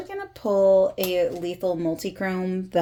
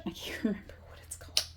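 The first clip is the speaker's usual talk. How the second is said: whispering; 12 dB quieter, much faster than usual. Real, not acted. Fast whispered aside with laugh.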